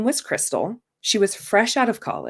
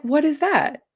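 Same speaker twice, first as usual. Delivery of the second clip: alarmed surprise; 8 semitones higher than usual. Retold or imitated. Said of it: "I was like".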